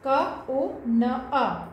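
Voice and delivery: very slow, syllable by syllable; teacher spelling slowly for children; spelled out slowly. Shown as a demonstration. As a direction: draw out each sound of क ओ न अ for the class. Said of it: "तो हमें कोना कैसे लिखना है?".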